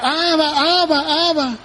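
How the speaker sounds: lightly voiced child calling Abba through tears; child in the market crying for his father, mildly voiced.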